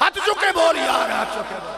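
loud shout that starts high and falls; rally the congregation to respond; shouted demand to the crowd; rousing call. Real, not acted. Urging crowd to respond, hands raised.

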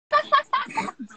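short high-pitched burst of laughter. Real, not acted. Laughing at the live chat.

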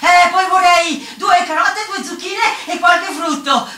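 high, loud, slow-starting grand greeting; declaimed order. Acted. Customer greeting the shopkeeper, loud high declamation. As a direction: proclaim the shopping order to the counter.

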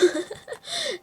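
high burst of laughter. Real, not acted. Streamer laughing high after escaping a player.

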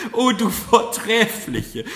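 high, loud, drawn-out adoration; impassioned cry. A narrator's reading. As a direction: exclaim in overwhelmed admiration. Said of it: "rief sie".

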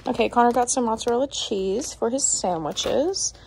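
normal talking voice

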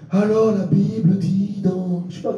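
mock-solemn, stretched delivery; mock Bible teacher. Mimics someone telling vague stories.

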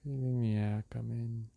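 unhurried meditation-guide voice with long pauses; guided meditation. Real, not acted. Meditation guide leading listeners through breath and release, calm and unhurried. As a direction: guide them gently through each breath, leaving space between phrases.

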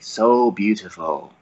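slow, creepy villain whisper-softness; villain leaning in to a hostage before killing; menacing villain. Retold or imitated. Creepy villain purr, slow. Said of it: "go up to one of the hostages and say".

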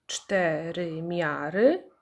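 "cztery miary" very slow and drawn out; syllable by syllable. Shown as a demonstration. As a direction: stretch "cztery miary" evenly, syllable after syllable. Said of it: "ilość sylab w nazwie pokrywa się".

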